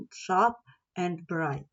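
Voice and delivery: ordinary delivery, nothing marked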